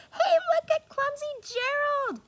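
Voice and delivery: high, loud jeering voice; mock him from the crowd; sneering animals. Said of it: "the animals all sneered".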